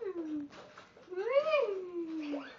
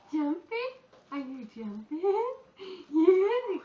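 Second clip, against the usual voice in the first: normal talking voice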